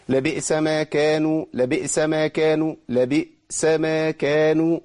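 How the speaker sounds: لبئس with a clear, still glottal stop on the hamza; hamza demo. Shown as a demonstration. Teacher demonstrating the sukun hamza to students. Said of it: "أقول لبئس".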